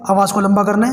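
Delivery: usual speaking voice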